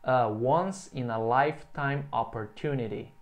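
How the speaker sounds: slow, careful English model phrase; teacher modelling for repetition; model sentence. Shown as a demonstration. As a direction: say it slowly for the class to repeat. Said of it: "Então, repeat".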